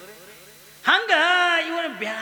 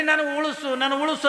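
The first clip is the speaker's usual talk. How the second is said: high, hammed-up desperate plea; pleading to be saved; 6 semitones higher than usual. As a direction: beg for your life, half in jest. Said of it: "ಹತ್ಕೊಂಡು ಒದ್ದಾಡತ್ತ". (ಎತ್ತರದ ಧ್ವನಿಯಲ್ಲಿ ಅಣಕದ ಬೇಡಿಕೆ)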